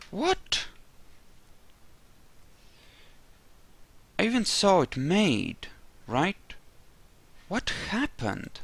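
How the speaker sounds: rising, incredulous what; can't believe the gear is gone; baffled disbelief. Real, not acted.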